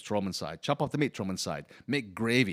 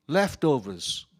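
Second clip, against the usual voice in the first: normal talking voice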